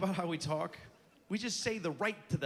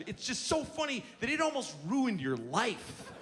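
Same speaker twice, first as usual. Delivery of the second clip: ordinary talk, nothing marked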